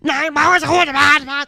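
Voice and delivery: high, loud mock German rant; mad German. Angry German gamer ranting at Brad, mock shouting. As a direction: yell in a hammed-up German accent. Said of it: "then when they're mad".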